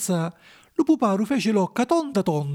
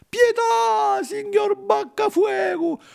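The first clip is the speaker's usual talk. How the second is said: acutissima e disperata; supplica piangente; much slower than usual. A narrator's reading. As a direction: implora piangendo a voce alta. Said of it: "chiangendo a cieli pierti". (Pinucciu implora il burattinaio piangendo, acutissimo)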